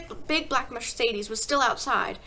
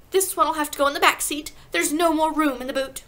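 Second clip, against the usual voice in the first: raised, loud matter-of-fact wife; higher, louder brusque mother; mother's complaint; 5 semitones higher, 7 dB louder than usual. A narrator's reading. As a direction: call it out briskly to the husband. Mother voiced in story.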